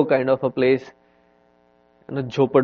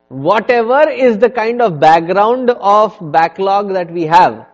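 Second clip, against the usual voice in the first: preaching build; 6 semitones higher, 10 dB louder than usual. Real, not acted. Sermon builds to climax.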